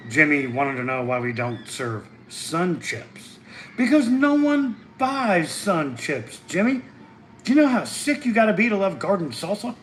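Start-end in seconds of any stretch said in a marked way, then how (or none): 3.8-9.7 mock exasperation. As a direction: roll your eyes at the silly request. Mock retort to Jimmy's request.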